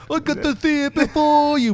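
high smug taunt; bragging jerk. Retold or imitated. Mocking Star Wars braggers, high smug gloat. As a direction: gloat like a jerk who saw it first. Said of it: "bragging jerk".

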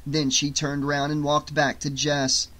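ordinary delivery

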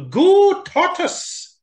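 squeaky high cheer; high cheering raccoon. Acted. Raccoon cheering for Tortoise, high. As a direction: cheer on the underdog.

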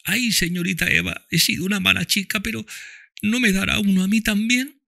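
put-on high girl voice, tearful; Topsy answers Ofelia through tears, high girl voice. A narrator's reading. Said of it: "apartando las lágrimas".

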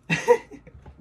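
high, loud cackling laugh; shrieking laugh. Real, not acted. Laughing at her own drawing, high shrieking laugh. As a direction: burst out laughing.

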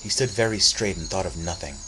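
usual speaking voice

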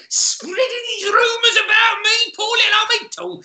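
put-on high, wounded old crone voice; self-pitying crone whine. Crone pitying herself over rumours, high and wounded. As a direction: wail like a hurt old hag.

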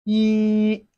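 "yi" held long on one level high pitch; flat tone. Shown as a demonstration. Teacher models Chinese one for learners, flat-tone "yi". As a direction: sustain "yi" without any bend. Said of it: "It has a flat tone".

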